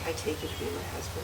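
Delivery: fast whispered vow line; whispered prompting. Real, not acted. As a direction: whisper the words for repeating. Officiant feeds vow lines.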